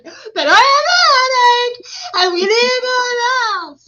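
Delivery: very high, loud mock wail; Selah wailing dramatically. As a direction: imitate a child's over-the-top meltdown. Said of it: "She's over there all dramatic".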